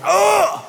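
high-pitched wounded "ugh"; stung groan. Retold or imitated. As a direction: react as if just stung. Stung reaction to teacher's remark.

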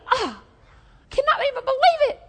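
high-pitched indignant lady; mock offence. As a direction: huff it high, as an affronted lady. Offended lady's acted reaction.

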